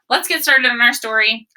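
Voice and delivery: ordinary delivery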